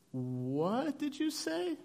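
high, slow, incredulous question; baffled parent. Retold or imitated. Parents puzzled by their son's odd wording, incredulous. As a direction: draw it out in squinting disbelief. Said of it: "squinty eye, neck tilted, like".

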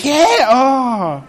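high, loud, gushing excited cheer; mock excited fan. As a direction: gush like an eager fan in the front row. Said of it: "like, all excited".

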